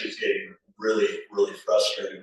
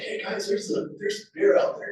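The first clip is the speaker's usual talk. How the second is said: excited; 12 semitones higher than usual. Retold or imitated. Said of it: "went in and told them".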